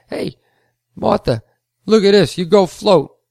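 loud, high mocking call to his wife; jeering shop owner calling out to his wife. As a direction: sneer it out to Martha, loud and high. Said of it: "said it in such a mocking way".

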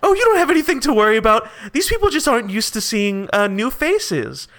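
upbeat official putting newcomers at ease; Barnabas. Acted. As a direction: wave away their worry, voice lifted. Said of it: "Barnabas, uh, notices you say this, and he's like".

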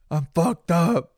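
put-on high, loud, slow drunk slur; drunken slur. Telling girlfriend at the door, high slow drunk slur. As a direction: stretch it out like a wasted guy. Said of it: "It's my first words".

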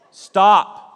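loud high command to stop; forceful stop; sharp stop. Acted. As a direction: bark it like a command.

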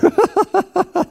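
high-pitched burst of laughter. Real, not acted. Amused at typewriter being outdated.

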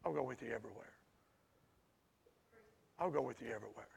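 almost hushed, reassuring the listener; tender promise. Acted. Tender promise of God, repeated.

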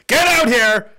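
loud call to get off the couch; rallying shout. Acted. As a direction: bellow it out to the lazy ones.

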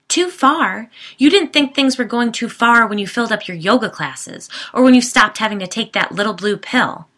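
loud, high furious retort; angry shouting. Acted. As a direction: throw his words back at him, yelling. Wife shouting in quarrel.

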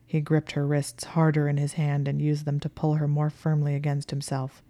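ordinary delivery

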